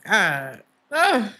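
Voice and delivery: loud, overwhelmed exclamation rising high; emotional. Real, not acted. Reader overwhelmed by a heart full of love, emotional. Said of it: "It's making me emotional".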